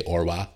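"Orwa" run together as one word, t dropped; Belfast slang. Shown as a demonstration. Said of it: "you say".

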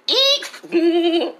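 sudden high squeal "eek". Real, not acted. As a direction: let out a sharp, startled squeak. Squeals mid-rant.

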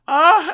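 short high yelp of a scream; mock scream. Retelling the operator's sudden scream, loud yelp. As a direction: scream it out sharply. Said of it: "she starts screaming".

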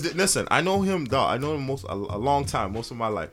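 ordinary talk, nothing marked

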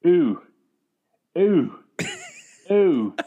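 mock Canadian moan, spaced out; Canadian accent.

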